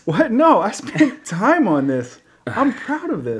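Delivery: laughing protest. Real, not acted. Mock protest while laughing.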